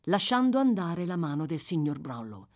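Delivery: ordinary delivery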